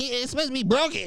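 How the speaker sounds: high, fast teacher voice; mock teacher. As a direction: mimic a fussing teacher, high and quick. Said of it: "teachers gonna be like".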